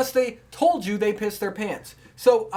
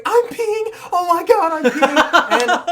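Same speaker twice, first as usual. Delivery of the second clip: panicked shriek; 12 semitones higher, 8 dB louder, slower than usual. Retold or imitated. Retold panicking girl wetting herself.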